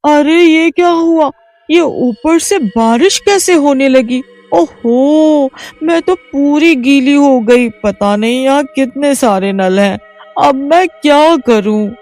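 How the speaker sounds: high, flustered cries at the shower; soaked and bewildered village woman; startled confusion. A narrator's reading. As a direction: caught off guard by water from above.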